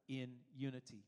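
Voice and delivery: "in unity" set apart at the end; spaced, falling "in unity"; emphasis on IN UNITY. Shown as a demonstration. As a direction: land deliberately on "in unity". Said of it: "it puts the emphasis on".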